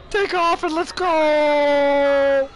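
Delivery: high, drawn-out yell; battle cry. Real, not acted. Gamer launching his plane, high drawn-out shout. Calling out battle command in game.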